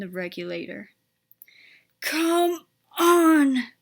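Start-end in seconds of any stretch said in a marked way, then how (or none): 2.0-3.7 higher and much louder, straining hard; puffing effort. A narrator's reading. Edward starting the heavy train, straining puff. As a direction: heave the words out like a hard pull. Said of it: "puffed Edward".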